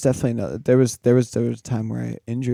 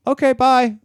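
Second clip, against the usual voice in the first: high, louder singsong mom send-off; breezy mom voice; 12 semitones higher, 6 dB louder than usual. Retold or imitated. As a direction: put on an airy mother's goodbye. Voices mom's breezy reply to son.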